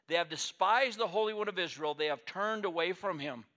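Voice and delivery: impassioned pulpit reading; preaching scripture. Acted. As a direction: declaim the prophet's rebuke. Reading Isaiah with force.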